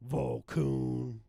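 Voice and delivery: Volkoon shouted loud. Pretend. Gamer shouting the Volkoon name at the screen. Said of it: "And then Volkoon".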